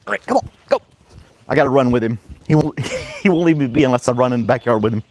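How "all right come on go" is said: high excited puppy voice; squeaky call to the dog; high-pitched dog talk. Real, not acted.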